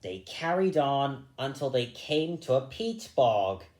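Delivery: usual speaking voice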